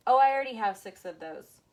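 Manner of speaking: loud, eager self-talk at the shelf; mock excited exclamation. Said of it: "I'm like".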